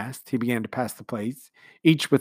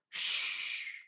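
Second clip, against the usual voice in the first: a breathy shush; hush; 12 dB quieter than usual. A narrator's reading. Tim hushing Jane during the speech, whispered. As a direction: hush her quickly.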